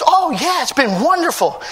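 high, delighted retold reply; lifted, joyful voice for a quote. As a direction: gush it happily, voicing a glad churchgoer. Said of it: "there's a joy to you".

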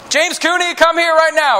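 high, loud stern call; parent summoning child. Acted. Parent to a child in trouble, stern summons. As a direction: call your kid over, in trouble. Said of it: "when your parents say your name".

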